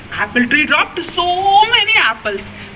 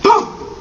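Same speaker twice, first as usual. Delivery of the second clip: boom sound effect; 7 semitones higher than usual. A narrator's reading. Storyteller to children, a sudden boom. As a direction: burst out boom like a crash.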